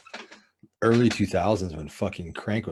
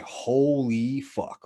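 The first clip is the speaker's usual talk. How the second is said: drawn-out, mildly raised amazed exclamation; retold amazement; 4 semitones higher, 4 dB louder, much slower than usual. Recalling the first high for viewers, amazed and drawn out. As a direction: stretch both words out in awe.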